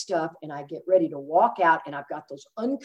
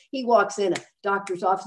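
ordinary talk

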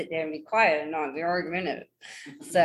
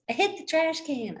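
mom's voice, pitched way up; imitating mom; 10 semitones higher than usual. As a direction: mimic mom calling out a high-pitched excuse. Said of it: "She rolls her window down and goes".

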